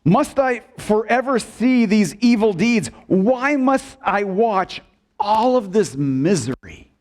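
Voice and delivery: high-pitched, anguished questioning; Habakkuk's complaint. Acted. Preacher voices the prophet questioning God, exasperated lament. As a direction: plead with God, drawing out the last question. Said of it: "and he talks like this".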